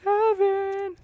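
calling out. Real, not acted. Calling out loudly.

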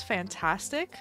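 usual speaking voice

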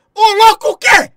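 high, loud shocked yelp; shocked outburst. Real, not acted. Streamer reacting to a chat message, shocked shout. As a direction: blurt it out in disbelief.

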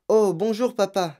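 put-on high, sweetly innocent little girl; butter him up, falsely sweet; sugary tone. Mathilda greeting her angry father, sugary sweet. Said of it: "fit-elle d'un ton sucré".

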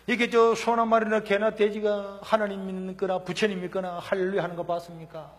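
normal talking voice

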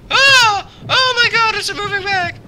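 high shrieking panic at a moving bag; real scared screams. Real, not acted. Gamer startled by a moving bag screams in fright.

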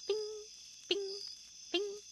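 very high bing bing bing; pinging. A narrator's reading. Narrator pinging the drips in the tin pail. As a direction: ring it like drops in a pail.

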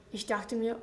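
plain reading voice

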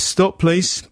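put-on officious young cop. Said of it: "He goes".

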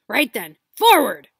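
very loud rising shout. Acted. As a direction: send the fleet off. Hagrid launching boats.

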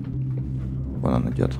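slow hushed whisper; whispering while hiding. Real, not acted. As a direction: breathe it out so he won't hear. Hiding from enemy in game.

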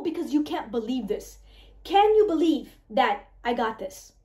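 ordinary delivery

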